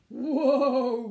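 loud, drawn-out "whoa" with a wobble; wobbly groan. Acted. Tortoise groans as the branch sways, loud wobbly cry. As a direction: groan it big and shaking. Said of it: "groaned Tortoise".

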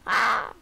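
a short loud cry of surprise; startled yelp. Real, not acted. Gamer jumpscared by granny, yelping. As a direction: gasp out a quick scared shout. Sudden scare in game.

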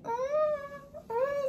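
soft, high female cat moan; imitated cat moan.

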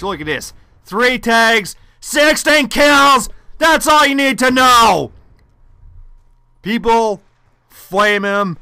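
loud, high, slow outrage at the sniper; rally viewers to flame him; angry rant. Real, not acted. Ranting at a camping sniper.

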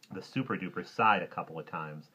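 usual speaking voice

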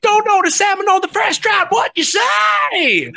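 high slurred hype, long final shout; hammed-up frontman nonsense to the crowd; gibberish stage patter. Singer asking Alaska for salmon, gibberish stage shout. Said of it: "I would literally just speak gibberish".

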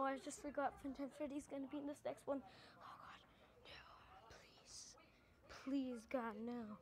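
normal talking voice

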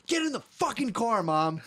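high-pitched mock tantrum yell; retold bratty order, voice way up; imitated wiling out. Kid ordering Mom into the car, mock tantrum. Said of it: "he was wiling out".

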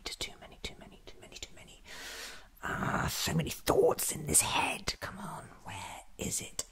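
normal talking voice

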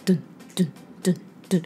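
fast rhythmic dun sounds tapping the beat; imitated beat. Shown as a demonstration. Teacher taps out a song's beat with her voice, quick dun. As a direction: voice the beat like a foot tap. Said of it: "你可能身体会跟它一起去点拍".

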